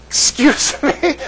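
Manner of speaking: high, drawn-out incredulous question; baffled. Retold or imitated. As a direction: act out not catching a word. Baffled reply to garbled accent.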